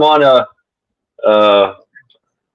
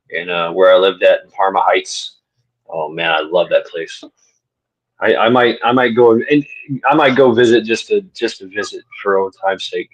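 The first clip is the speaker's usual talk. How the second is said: normal talking voice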